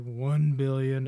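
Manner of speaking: loud, slow, flat-faced number; deadpan answer. Retold or imitated. Rogen's character answering his wife, mock deadpan. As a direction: drop the figure like it's nothing.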